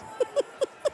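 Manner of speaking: high breathless giggle; high giggle burst. Real, not acted. Giggling fit after slip-up.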